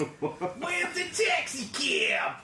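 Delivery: put-on high, slow shouted New York accent; hammed-up New York yell. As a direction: holler for a cab like a New Yorker.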